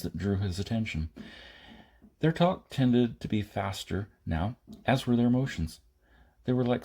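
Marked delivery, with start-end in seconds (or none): none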